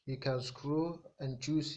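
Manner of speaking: normal talking voice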